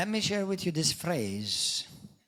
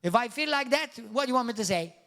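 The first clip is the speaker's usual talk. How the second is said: retorting churchgoer; 5 semitones higher, 5 dB louder, faster than usual. Retold or imitated. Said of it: "He said".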